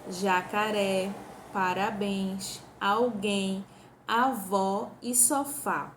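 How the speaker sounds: slow, each word stretched, final syllable of "Jacaré", "parabéns", "sofá" leaned on; stressing last syllables. Shown as a demonstration. Teacher slowly stressing the oxytone words for the class. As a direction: draw out every word so the last syllable rings. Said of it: "Eu vou listar aqui algumas palavras".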